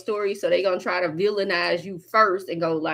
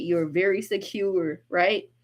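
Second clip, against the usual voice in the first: ordinary talk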